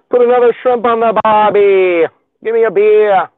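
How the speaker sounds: loud, high, hammed-up Aussie accent; terrible Australian accent. Livestreamer having a go at Aussie catchphrases, terrible fake Australian accent. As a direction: shout it like a cartoon Australian. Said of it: "Terrible also".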